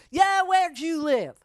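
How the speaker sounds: high, loud, slow demand; hammed-up indignant question; mock challenge. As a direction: bark it back like a skeptic. Guy challenges where he lived.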